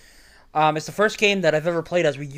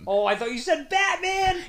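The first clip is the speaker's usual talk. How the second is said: surprised shout; 13 semitones higher than usual. Real, not acted. Mishearing, sudden realization.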